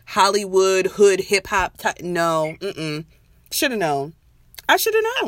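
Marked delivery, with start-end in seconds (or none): 4.7-5.3 much higher, sing-song self-mocking whine; mock whiny squeak. Cohost mocking her own regret, high mock whine.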